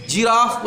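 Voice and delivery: जिराफ starting with a "j" sound; soft g. Shown as a demonstration. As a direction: model जिराफ, g softened to j. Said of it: "तुमको क्या पढ़ना है".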